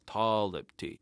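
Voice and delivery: ordinary talk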